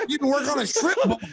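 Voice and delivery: high laughing taunt; heckler taunt. Retold or imitated. Heckler mocking coach, high and laughing. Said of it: "He says".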